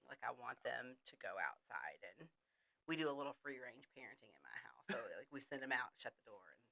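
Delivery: fast joking whisper. Real, not acted. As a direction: whisper it as a confession. Confiding joke about parenting.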